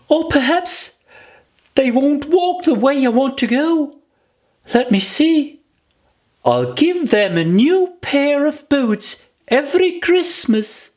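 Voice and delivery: put-on high, musing girl's voice; Alice's voice. Alice planning presents for her feet, high and musing. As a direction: think it through like a clever little girl.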